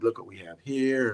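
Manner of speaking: a light mock Southern accent; lightly imitated Southern accent. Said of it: "They got that Southern accent".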